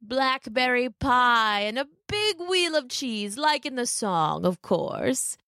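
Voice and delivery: slow, swooping wolf voice; sly wolf. A narrator's reading. Wolf to Little Red about her treats, slow and sly. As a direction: savour it slowly like a storybook wolf.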